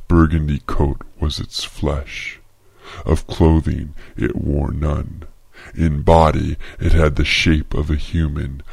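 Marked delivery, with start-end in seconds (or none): none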